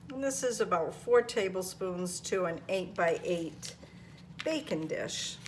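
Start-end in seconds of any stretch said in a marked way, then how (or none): none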